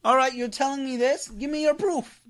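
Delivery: high, pushy demand for proof; mock challenge. Answering an imagined Salafi, a high mock demand for proof. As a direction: toss the challenge back at him, pitched high. Throwing the demand back mockingly.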